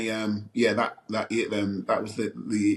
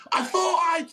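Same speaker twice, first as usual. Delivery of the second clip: high, loud mock yell; retold loud yell. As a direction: shout it so everyone can hear. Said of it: "loud as the hell".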